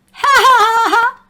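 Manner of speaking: high, loud sing-song ha ha ha; a giggling class laughing at the teacher; high mocking laugh; mock laughter. A narrator's reading. Class giggles at dropped Earth.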